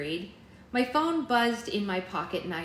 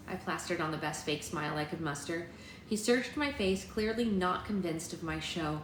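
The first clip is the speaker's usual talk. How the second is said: plain reading voice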